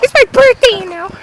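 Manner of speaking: put-on high, protesting little-kid voice; whiny kid. Birthday boy protests that it's his day, high kid voice.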